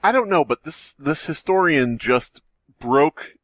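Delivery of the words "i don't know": usual speaking voice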